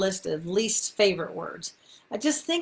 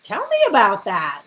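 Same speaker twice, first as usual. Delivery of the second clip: marvel, then ask to hear more; delighted surprise; 5 dB louder than usual. Shown as a demonstration. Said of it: "to do it, like".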